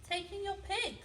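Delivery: high, knowing advice; wise old man advising. Acted. Wise old man advises the old lady, high knowing voice. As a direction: suggest it brightly, voice lifted. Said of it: "said the wise old man".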